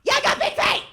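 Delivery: very high, loud confrontational shout; defiant yell. Retold or imitated. Turning on the heckler, high defiant shout. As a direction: yell back in his face. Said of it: "I was like".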